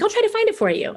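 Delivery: a hint of the cousin, pitched well up and helpful; cousin's voice.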